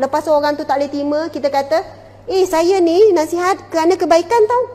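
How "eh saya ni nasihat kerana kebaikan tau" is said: higher, louder, defensive snap; indignant retort. Acted. Adviser bristling when her advice is rejected, higher and louder indignation. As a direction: push back like your good intentions are being doubted. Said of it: "Tiba kita pula nak marah".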